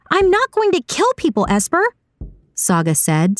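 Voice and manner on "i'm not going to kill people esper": very high-pitched, appalled protest; appalled high character voice, not shouted; outraged refusal. A narrator's reading. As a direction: refuse point-blank, voice shooting up. Saga refuses to kill.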